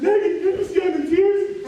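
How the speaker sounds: high, loud mock outrage; indignant protest. Laid-off friend accused of nagging, acted indignant protest. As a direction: wail it back, hammed-up and offended.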